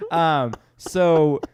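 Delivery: ordinary delivery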